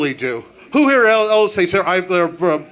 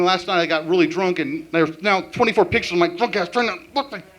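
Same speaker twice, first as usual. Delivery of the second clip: usual speaking voice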